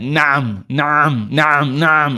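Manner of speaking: mock an over-done throaty na'am; strained Arabic na'am; exaggerated na'am. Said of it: "it's exaggeration".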